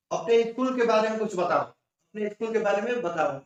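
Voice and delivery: ordinary delivery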